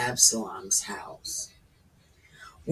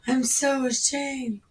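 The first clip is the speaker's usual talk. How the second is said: mock ashamed girl; 8 semitones higher than usual. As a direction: squeak it like a shamed virgin. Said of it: "this is a virgin thing".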